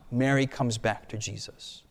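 ordinary talk

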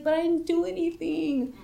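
high, helpless retold plea; pleading protest. Suspect answers police urging confession, high pleading protest.

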